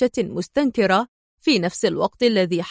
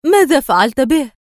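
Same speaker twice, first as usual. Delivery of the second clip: higher, louder urgent question; exclaimed question; 4 semitones higher, 6 dB louder than usual. A narrator's reading. Rachel emerging from hiding to question Adham, exclaiming. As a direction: call out the question sharply. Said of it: "فهتفت راشيل".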